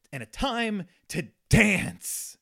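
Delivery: slow, loud, hammed-up proclamation; Kevin Bacon in Footloose. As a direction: stretch it out and punch the last word. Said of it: "the part that Kevin Bacon talks about in Footloose".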